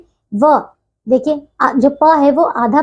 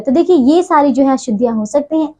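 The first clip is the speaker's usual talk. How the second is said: ordinary talk, nothing marked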